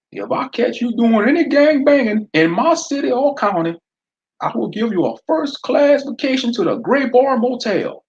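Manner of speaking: southern sheriff daring Fred to use his eye blaster; southern accent. Acted. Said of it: "he told Pookie".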